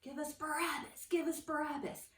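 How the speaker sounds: restrained crowd chant; mock crowd yell. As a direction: imitate a yelling crowd, holding back the volume. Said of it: "they started yelling".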